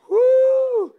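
very high, loud held "woo"; mock shriek. Preacher mimics reaction to his reveal, mock shriek. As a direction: let out a sustained high whoop.

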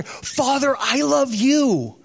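overly sweet, drawn-out love declaration; lightly voiced, sentimental retelling of a storyteller's version; mock tender. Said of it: "And Jesus would say to the Father".

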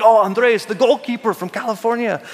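lightly put-on European accent, unsure which country; a hint of a Belgian or Dutch accent for a fan greeting; vague European accent. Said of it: "I don't know what accent that was".

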